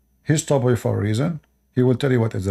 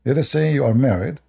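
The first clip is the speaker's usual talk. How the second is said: ordinary talk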